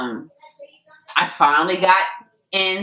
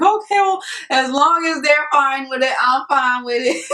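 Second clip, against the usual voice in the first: high, breezy shrug of a voice; mock casual; 8 semitones higher than usual. Retelling her reply about the sellers, breezy and high. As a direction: play it cool, pitched way up.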